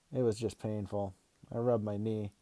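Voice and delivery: usual speaking voice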